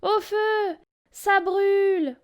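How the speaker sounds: high, slow cry of pain; high panicked yelp. A narrator's reading. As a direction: howl about the burning nose. Wolf stung by nettles.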